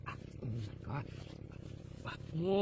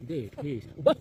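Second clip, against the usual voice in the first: ordinary talk, nothing marked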